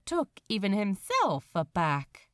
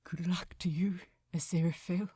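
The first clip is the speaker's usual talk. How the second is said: whispered farewell; 3 semitones lower, much faster than usual. Acted. Shipbuilder bids angel goodbye, whispering through a forced smile. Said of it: "as he tried his very hardest to smile".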